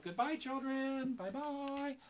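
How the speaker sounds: high squeaky puppet goodbye; squeaky ventriloquist dummy. Acted. Puppet says goodbye to children.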